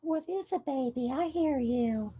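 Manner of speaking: hushed, tender words to a cat; soft pet talk. Real, not acted. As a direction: coo gently at the pet. Talking softly to her cat.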